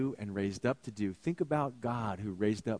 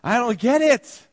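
a sudden louder, higher-pitched cry of bafflement; exasperated outburst; 10 semitones higher, 10 dB louder than usual. Real, not acted. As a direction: throw up your hands, overloaded. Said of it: "my brain begins to short circuit".

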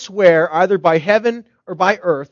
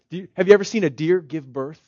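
usual speaking voice

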